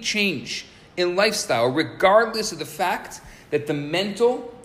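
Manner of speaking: loud, high, impassioned sermon voice; fervent preaching. Acted. Rabbi preaching to listeners about the starving soul, fervent and loud. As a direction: preach it to the room with fire.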